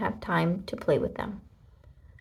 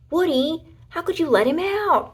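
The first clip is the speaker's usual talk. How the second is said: much higher, louder upset cry; 9 semitones higher, 5 dB louder than usual. A narrator's reading. Cowgirl cries out at Woody for freeing hamster, high and upset. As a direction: cry out in dismay. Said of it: "cried Jessie".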